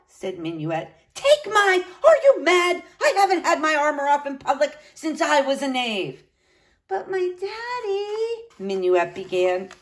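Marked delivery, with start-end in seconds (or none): 1.1-6.2 outraged. A narrator's reading. Knight aghast at removing armor, outraged. 6.9-8.5 high, slow, soft whine; little niece, pleading. A narrator's reading. Niece pressing uncle about daddy, pleading.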